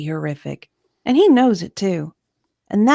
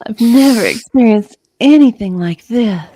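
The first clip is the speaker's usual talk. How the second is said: louder, mock-dramatic redo of the line; hammed-up retake; 2 semitones higher, 7 dB louder than usual. Shown as a demonstration. Guest hams up the line for the host's show. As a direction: redo it as an overacted soundbite. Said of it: "They just write themselves".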